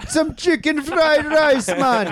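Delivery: high, hammed-up Jamaican accent. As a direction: put on a caricature Jamaican street-seller. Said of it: "they have Jamaican accents".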